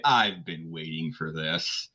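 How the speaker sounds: low, slow villain's gloat; smug, unhurried menace; snickering villain. Acted. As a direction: say it low and drawn out, with relish. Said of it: "just kind of snicker and say".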